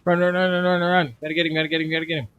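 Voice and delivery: frantic run-run chant; being chased. Real, not acted. Chasing escaping creature, urgent.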